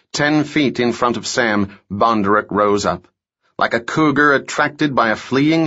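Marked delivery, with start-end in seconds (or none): none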